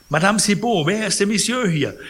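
high, loud startled call; sick old man crying out in alarm. Acted. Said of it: "rief er".

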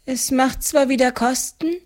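high, grateful woman's voice; relieved older woman. A narrator's reading. Debtor thanks the farmer for delay, relieved. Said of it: "atmete erleichtert auf".

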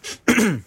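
a high nervous throat clear. Acted. Busse clearing his throat before the assembly, nervously. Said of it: "Er räusperte sich".

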